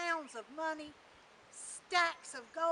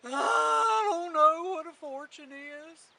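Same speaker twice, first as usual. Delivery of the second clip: loud, slow donkey wail; crying old donkey; 3 semitones higher, 10 dB louder, slower than usual. A narrator's reading. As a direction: bray the words out, drawn out and loud. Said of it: "they heard him a-crying".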